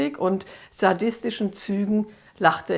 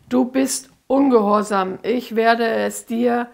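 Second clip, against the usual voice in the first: contemptuous threat; 2 semitones higher, 4 dB louder than usual. Acted. Demon threatens the disobedient girl, loud and contemptuous. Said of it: "verächtlich spuckte er auf den Boden".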